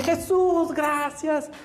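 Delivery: high-pitched, elated outpouring of thanks; euphoric. Acted. Juan at the feet of Jesus, euphoric. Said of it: "Pero así, eufórico".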